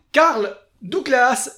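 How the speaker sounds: loud, slow drawn-out showbiz call; announcer voice. Acted. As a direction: boom out the name like a TV host. Annonce l'artiste suivant. (voix d'animateur de variétés)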